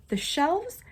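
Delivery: ordinary delivery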